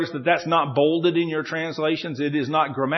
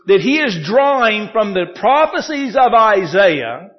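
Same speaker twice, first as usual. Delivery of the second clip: loud, raised, slow-stretched key words; emphatic preaching; 4 semitones higher, 5 dB louder, slower than usual. Real, not acted. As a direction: drive the point home from the pulpit. Preaches his main point.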